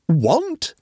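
high, rising one-word question; incredulous echo. A narrator's reading. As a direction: throw the word back in disbelief.

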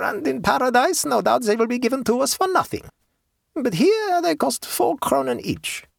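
put-on high German-accented haggler; German peasant woman. A narrator's reading. Woman presses her bargain.

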